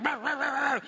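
high, frantic mock barking; imitated barking. Preacher acting out his dog chasing the truck, imitated barking. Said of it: "and he's barking".

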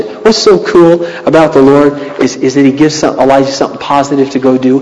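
normal talking voice

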